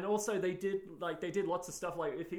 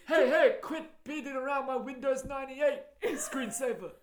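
high, loud fussy scolding; mock commentator; 9 semitones higher, 7 dB louder than usual. Continuing the riff, high and indignant. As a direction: scold like a set builder guarding his props. Friends trading commentator impressions.

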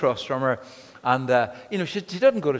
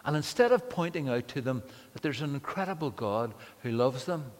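normal talking voice

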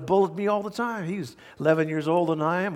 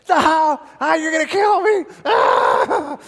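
put-on high, loud squealing cry of a pinned kid; retold panicked scream; 11 semitones higher, 10 dB louder, slower than usual. Little boy screaming up at his big brother, high and loud. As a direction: scream like your brother's spit is coming down.

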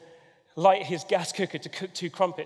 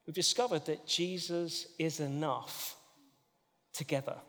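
soft sincere; 6 dB quieter than usual. Acted. Preacher drops to soft tone.

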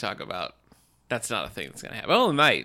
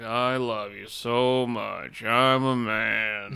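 very slow, dull American man voice; boring, drawn-out American male impression; mock American man; much slower than usual. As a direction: imitate a badly faked American man, dragging each word. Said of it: "like, really slow and boring and dull".

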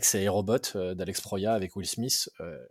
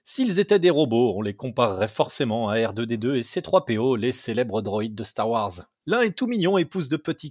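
podcast intro; 5 dB louder than usual. Acted.

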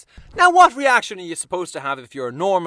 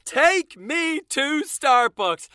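high, slow begging; weary, drawn-out plea; pleading; 7 semitones higher, much slower than usual. Real, not acted. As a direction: drag it out like a desperate plea. Exasperated plea.

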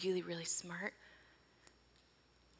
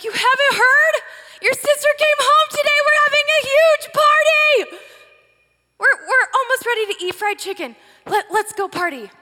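excited family friend; 14 semitones higher, 12 dB louder than usual. Acted. Family friend explains the party.

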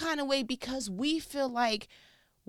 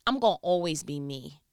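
normal talking voice

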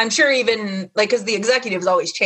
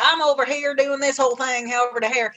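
higher-pitched generic Southern twang; generic Southern accent; 5 semitones higher than usual. Retold or imitated. Podcast guest mocking the accent heard everywhere, generic Southern accent. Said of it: "that accent that just pops up no matter where you are".

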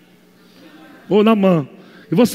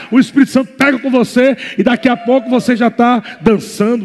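ordinary talk, nothing marked

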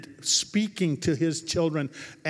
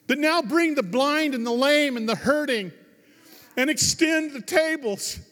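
high, ringing sermon climax; full-force preaching; 9 semitones higher, 4 dB louder than usual. Real, not acted. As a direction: proclaim it loudly to the whole congregation.

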